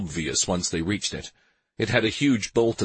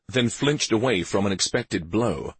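ordinary delivery, nothing marked

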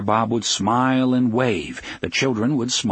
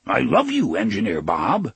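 ordinary delivery, nothing marked

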